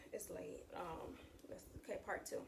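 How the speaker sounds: late-night hush. Real, not acted.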